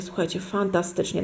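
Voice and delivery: ordinary talk, nothing marked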